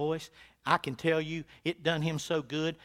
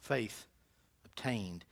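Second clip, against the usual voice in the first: quiet restatement; 5 semitones lower, 7 dB quieter, slower than usual. Acted. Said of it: "Let me close".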